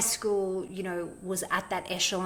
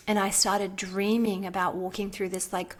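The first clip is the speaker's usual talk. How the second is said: ordinary talk, nothing marked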